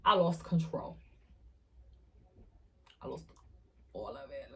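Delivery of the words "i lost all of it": breathy whisper, barely there. Real, not acted. Telling the audience she lost control, whispered. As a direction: drop to a whisper. Quiet admission of losing control.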